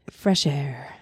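low, soft public radio host; NPR voice. Shown as a demonstration. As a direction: deliver it like a mellow radio announcer. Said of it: "It's my N P R voice".

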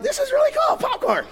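high, soft mock-mellow voice.

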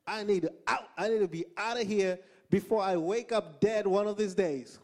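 high, loud plea to escape; mock panic. Husband fearing his mafia in-laws, mock panic. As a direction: plead high and loud, exaggerating the danger.

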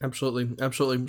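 talking while eating; words round a bite of bread; mouth full. Real, not acted. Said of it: "Sorry, I'm eating a, eating a cake right now".

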